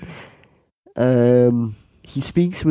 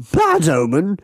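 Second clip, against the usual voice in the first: higher, incredulous English character voice; Englishman; 4 semitones higher than usual. Acted. Middle-aged Englishman dismisses the tall man's warning, mock English accent. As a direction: scoff as a pompous Englishman. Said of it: "says a middle-aged Englishman".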